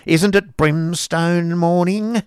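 loud, cross schoolmaster's wife; Mrs Squeers grumbling. Acted. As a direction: grumble at the cupboard. Mrs Squeers scolds husband.

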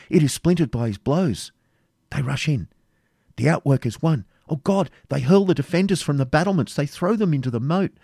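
agitated Rebecca, horrified excitement. A narrator's reading. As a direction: build to a horrified appeal. Rebecca reports the gate falling.

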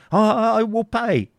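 high, stammering surrender; breaking down. Acted. Isaac giving way before the furnace, stammering. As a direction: give in with a frightened stammer.

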